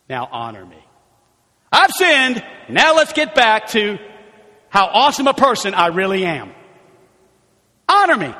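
loud, high rushed sinned; hasty confession. Acted. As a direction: blurt it and demand honor. Voicing Saul's quick confession.

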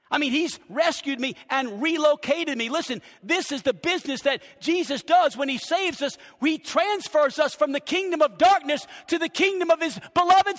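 high, loud, driving sermon delivery; full-force preaching. Real, not acted. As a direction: preach it out to the whole church.

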